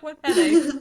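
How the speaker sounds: high, loud laughing mock question; giggly high what headache. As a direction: laugh it out as a baffled kid. Retold kid, laughing.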